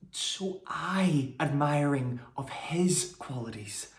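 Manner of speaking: slow, measured stage verse with lifted, swelling key words; dramatic Shakespeare monologue. Acted. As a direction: brood through the verse slowly, leaving long pauses. Performs Shakespeare monologue as Helenice.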